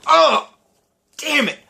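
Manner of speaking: frustrated outburst. Real, not acted. Host groaning at a quiz answer gone wrong. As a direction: blurt it out like a near miss slipped away.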